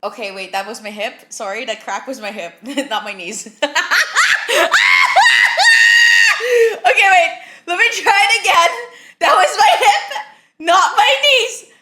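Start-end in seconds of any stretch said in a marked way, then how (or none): 3.5-6.8 much higher, loud real laughing shriek; loud high cackle of real amusement. Real, not acted. Bursts out after the hip crack. 6.8-8.7 higher and louder, words bubbling with real laughter; real laughter breaking up the words; laughing. Real, not acted. Still laughing at herself. 9.2-11.7 much higher, louder and slow, shaking with real laughter; real laughing repeat of the same words. Real, not acted.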